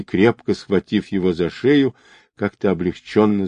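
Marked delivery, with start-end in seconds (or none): none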